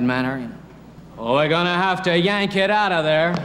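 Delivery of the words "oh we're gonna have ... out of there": a tough Western hero playing surgeon; blunt cowboy surgeon voice; John Wayne. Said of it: "imagine if John Wayne were interested in".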